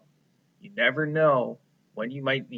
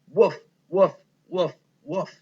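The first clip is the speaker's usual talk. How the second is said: higher, louder barking woofs; dog bark; 6 semitones higher, 6 dB louder than usual. Acted. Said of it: "It looks like she's saying".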